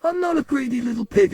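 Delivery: high-pitched protest; indignant child. Acted. Boy answering his brother's accusation, indignant. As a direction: deny it in a high, wounded voice. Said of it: "So I said".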